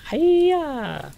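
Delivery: high-pitched throwing yell; drawn-out mock karate cry; playful battle cry. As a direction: yell it as you hurl something. Calls out while throwing a flare.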